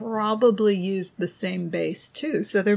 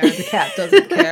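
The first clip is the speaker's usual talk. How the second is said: laughing; 10 semitones higher, 8 dB louder than usual. Real, not acted. Laughing about the cat.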